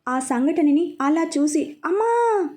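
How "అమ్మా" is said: high, sharp cry for her mother; anguished scream. A narrator's reading. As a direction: scream out as the car explodes. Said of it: "అని గట్టిగా అరుస్తుంది". (అమ్మా అని గట్టిగా అరుపు)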